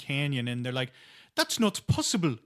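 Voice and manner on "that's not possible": high-pitched mock character voice. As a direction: put on a high caricature. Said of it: "I know that's very offensive".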